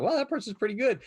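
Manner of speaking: high, loud impressed exclamation. Retold or imitated. Viewer reacting to a stranger's skilled play, impressed. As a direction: exclaim in awe, voice jumping up.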